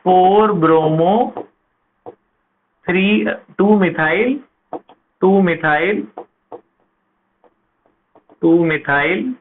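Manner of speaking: Four bromo spelled out slowly, word by word; teacher reading out a name at writing speed; slow dictation. Shown as a demonstration. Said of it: "तो इसका नाम क्या हुआ?".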